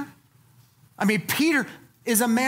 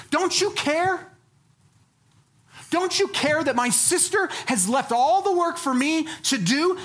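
confronting Jesus; 7 semitones higher than usual. Retold or imitated. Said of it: "She confronts Jesus".

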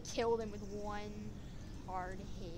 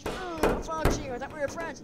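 raised, loud hurt protest; acted accusation; 6 semitones higher, 7 dB louder than usual. Betrayed toy confronting a friend, indignant. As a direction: throw the accusation out, voice pitched up.

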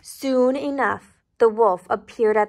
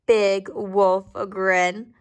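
plain reading voice

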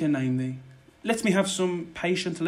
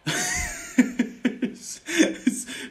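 mocking laugh. Villain mocks the cursing lady.

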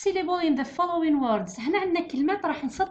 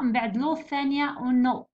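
usual speaking voice

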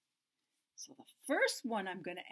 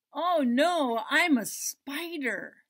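slow, louder spider reply; 5 semitones higher, 11 dB louder, much slower than usual. Acted. Spider answering the seeking cat, slow and louder.

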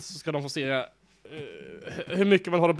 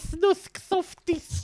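lisping; 12 semitones higher, much slower than usual. Pretend. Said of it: "Jag läspar".

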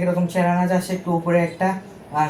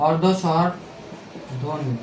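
very slow, softer, word stretched out with a pause inside; slow dictation; 2 semitones lower, 5 dB quieter, much slower than usual. Shown as a demonstration. Teacher repeating the key term for students, slow dictation. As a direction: spell out অর্ধ স্বরধ্বনি slowly, piece by piece.

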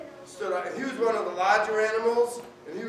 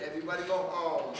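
ordinary delivery, nothing marked

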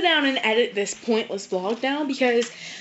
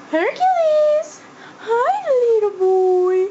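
high, loud squealed greeting; 11 semitones higher, 5 dB louder, much slower than usual. Real, not acted. Greeting little boy Hercules.

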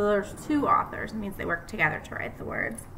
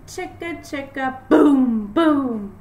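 crashing booms; 4 semitones higher, 10 dB louder, much slower than usual. Acted. Teacher reading to kids, booming crash sound in the refrain. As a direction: make the booms land like a crash.

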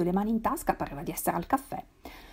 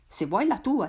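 plain reading voice

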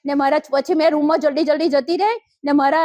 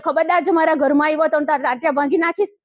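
normal talking voice

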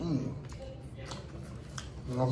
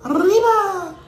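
pitched-up playful shout of arriba; playful exclamation; 9 dB louder, much slower than usual. Real, not acted. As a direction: belt it out for fun. Playful shout mid food list.

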